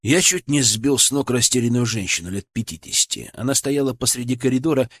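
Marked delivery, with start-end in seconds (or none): none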